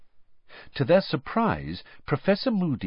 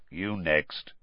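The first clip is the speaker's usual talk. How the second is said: growl; 5 semitones lower, 5 dB quieter than usual. A narrator's reading. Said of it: "Moody growled".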